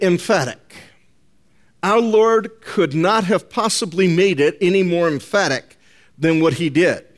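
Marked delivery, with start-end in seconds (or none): none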